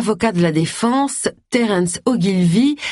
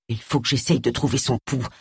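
low, softer, hesitant Phil; apprehensive; 5 semitones lower, 5 dB quieter than usual. A narrator's reading. Phil reaching for Harriet's pulse, apprehensive and soft. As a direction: say it softly, dreading what he'll find. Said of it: "dit Phil avec appréhension".

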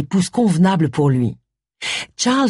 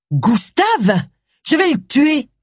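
high furious outburst rising; enraged threat; 10 semitones higher, slower than usual. Acted. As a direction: explode at the name in rage. Furious wife hears of butler.

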